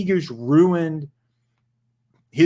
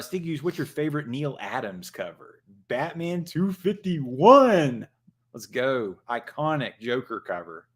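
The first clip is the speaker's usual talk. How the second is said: ordinary delivery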